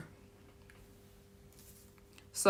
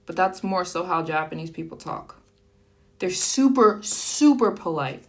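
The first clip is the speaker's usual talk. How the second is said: ordinary talk, nothing marked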